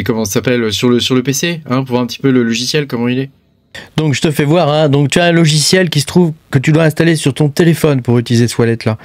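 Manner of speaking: ordinary talk, nothing marked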